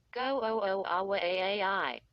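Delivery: put-on raspy, crackling old-record voice; scratchy recorded voice. A narrator's reading. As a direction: rasp it like a worn phonograph. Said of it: "the dummy rasped in a scratchy recorded voice".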